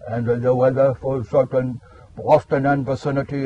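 robotic weather radio voice; automated voice. Retold or imitated. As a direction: read it like a machine forecast. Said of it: "it's an automated voice".

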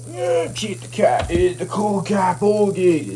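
ordinary talk, nothing marked

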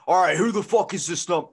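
loud, gruff caller voice; hammed-up angry guy on the phone. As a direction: bark it like an irate stranger. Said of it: "He goes".

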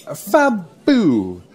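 high, drawn-out gush; gushing waiter. Acted. Waiter approves diner's water choice, gushing. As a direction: gush over the order with swooping pitch. Waiter gushes over order.

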